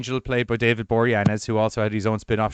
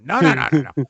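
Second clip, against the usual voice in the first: louder, higher no no no; mock loud refusal; 3 semitones higher, 8 dB louder, faster than usual. Angel refusing to ease off, loud mock no. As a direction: bark out the refusals like a gleeful villain. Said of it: "And he's like".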